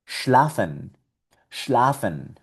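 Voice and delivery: "Schlafen" with sh then l; German schlafen. Shown as a demonstration. Teacher models the German word, careful pronunciation. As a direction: say "Schlafen", sh first. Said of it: "otra palabra con esa combinación".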